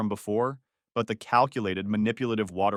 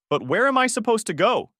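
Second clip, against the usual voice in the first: high, louder desperate cry; near wail; 9 semitones higher, 5 dB louder than usual. A narrator's reading. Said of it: "she practically wailed".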